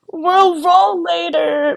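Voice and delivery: high, drawn-out, hammed-up whine; mock-whiny promise. Sick client putting off gym trainer, mock-whiny. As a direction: stretch it out in a tearful mock plea. Her reply to trainer acted.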